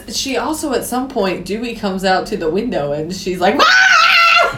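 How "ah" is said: long, very high-pitched imitated shriek; mock scream. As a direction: let out a hammed-up squeal of fright. Imitates her screaming at the window.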